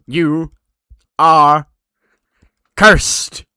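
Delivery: loud, high, drawn-out curse; spooky curse. Retold or imitated. Danhausen cursing Johnny, loud and theatrical. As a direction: pronounce the curse dramatically.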